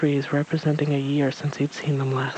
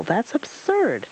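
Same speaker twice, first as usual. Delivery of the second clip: scornful; 8 semitones higher, slower than usual. Acted. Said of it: "screeched Owl".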